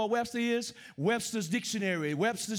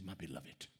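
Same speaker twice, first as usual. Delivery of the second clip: far softer, much lower murmur; tender aside; 16 dB quieter than usual. Real, not acted. Preacher tenderly addresses his beloved congregation, hushed and low. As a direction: drop to an intimate murmur.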